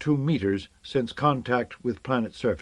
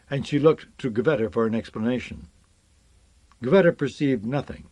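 ordinary delivery, nothing marked

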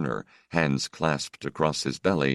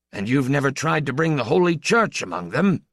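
higher, louder challenge; haughty stranger priest; 11 semitones higher, 4 dB louder than usual. Acted. Stranger priest to the old priest, challenging. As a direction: press him like a proud churchman.

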